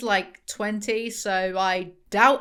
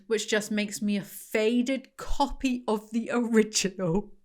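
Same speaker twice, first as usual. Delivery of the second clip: usual speaking voice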